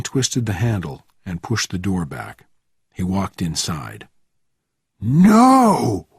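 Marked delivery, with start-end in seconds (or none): 5.0-6.1 high, loud roar of shock; horrified bellow. A narrator's reading. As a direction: roar the word out, drawn long. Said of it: "roared the sergeant".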